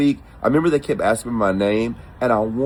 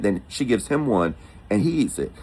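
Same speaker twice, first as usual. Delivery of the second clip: usual speaking voice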